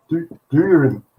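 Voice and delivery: words not coming easily; halting. A narrator's reading. Husband struggling to tell his wife a memory, halting. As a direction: stumble and break off, struggling to get it out. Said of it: "The words were not coming easily".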